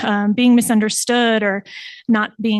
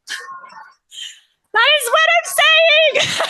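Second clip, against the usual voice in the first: thrilled exclamation. Retold or imitated. Therapist thrilled by client's insight.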